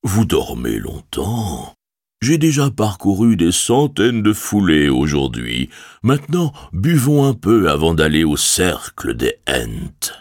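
a hint of an ancient tree voice; unhurried, rumbling old Ent. A narrator's reading. Treebeard voiced in story.

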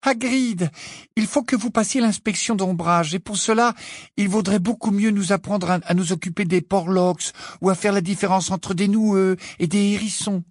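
put-on high, earnest girl's plea; grave. A narrator's reading. Pupil tells Hagrid to pass the inspection, grave. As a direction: urge him seriously in a high, even voice. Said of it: "dit Hermione d'un ton grave".

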